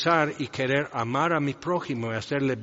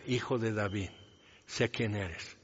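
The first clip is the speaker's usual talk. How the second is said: hushed plea; 4 semitones lower, 8 dB quieter than usual. Acted. Pleading woman's quiet confession.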